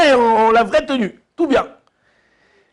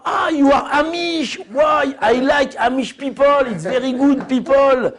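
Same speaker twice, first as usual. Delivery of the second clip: gushing woman; slower than usual. Retold or imitated. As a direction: ham up the admiring stranger. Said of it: "Et la meuf, elle le voit". (dame enthousiaste, voix aiguë et lente)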